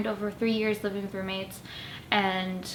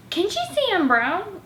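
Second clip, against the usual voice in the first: pitched up high in disbelief; shocked aside at the roommate's remark; incredulous; 10 semitones higher, 3 dB louder than usual. Retold or imitated. Brown vlogger hearing roommate's comment, stunned disbelief.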